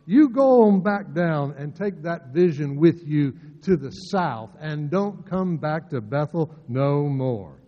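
a hint of a Southern bully ordering him off; bullying Southern accent. Amaziah bullying Amos to leave, mild Southern accent. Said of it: "You can almost hear the accent of his voice".

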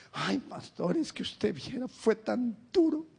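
slow, drawn-out whiny plea to the pastor; complaining congregant. Retold or imitated. A churchgoer pleads with the pastor, whiny and slow. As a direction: play a hurting churchgoer begging for sympathy.

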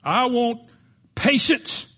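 high, loud preaching climb; full-force preaching. Acted. Preacher warning the congregation about impatience, loud and high.

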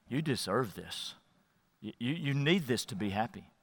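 very low, hushed and coaxing; quiet tempter in your ear; tempting inner voice. Acted. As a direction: play it down in a soft murmur. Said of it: "what it's gonna sound like in your ears".

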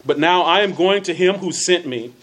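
emphatic, well-enunciated Bible reading; deliberate scripture reading. Real, not acted. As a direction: read scripture out with careful elocution. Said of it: "read them a little slower and with allocution".

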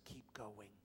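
breathy hushed keep going; soft urging whisper; whispered encouragement. Retold or imitated. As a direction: whisper it close to a weary man.

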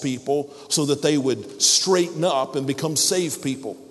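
rising, fervent prayer voice; closing prayer. Acted. Pastor praying for the congregation, fervently. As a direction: pray over the congregation with mounting fervor.

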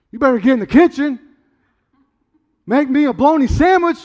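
loud, high-pitched bossy order; mock-domineering holler at his wife; bossy demand. Husband pretending to order Julie into the kitchen, bossy shout.

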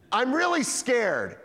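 high, drawn-out frightened plea; hammed-up scared voice; mock fear. Acts out a frightened person.

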